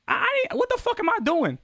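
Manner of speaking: very high-pitched, incredulous outburst; exasperated protest. Real, not acted. Streamer told to calm down for nothing, exasperated. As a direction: stammer into a high, baffled complaint.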